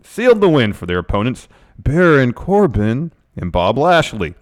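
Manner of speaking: hype preview read. Acted. Reading the preview to viewers, building announcer hype. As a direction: punch the names like a hype man.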